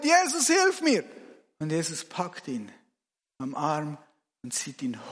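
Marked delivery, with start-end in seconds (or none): 0.0-1.0 high-pitched cry for help; desperate scream. Acted. Sinking disciple cries to Jesus for rescue, screaming. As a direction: yell out as you go under. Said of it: "Und er schreit".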